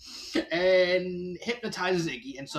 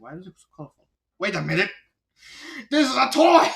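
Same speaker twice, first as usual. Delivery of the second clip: surprised; 5 semitones higher, 9 dB louder, faster than usual. Retold or imitated. Ludwig discovering the fake toy.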